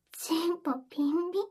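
normal talking voice

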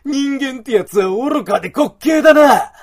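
high, loud mocking shout; scornful shout. Wolf man scorns foolish humans, loud mocking shout. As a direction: shout your contempt for humans.

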